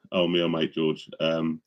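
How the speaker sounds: ordinary delivery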